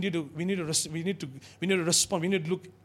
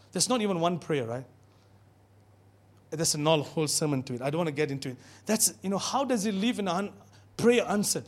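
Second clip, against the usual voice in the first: normal talking voice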